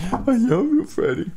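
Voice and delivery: high squeaky voice for a pet; baby talk to dog. Retold or imitated. Talking to his dog in high baby talk. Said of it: "petting him".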